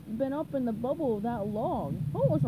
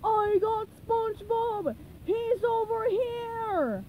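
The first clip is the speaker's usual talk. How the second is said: high, drawn-out holler; slow, high calling shout; sudden yell; 9 semitones higher, much slower than usual. A narrator's reading. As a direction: call it out to a distant crowd. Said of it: "he suddenly yelled".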